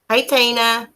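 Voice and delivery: ordinary delivery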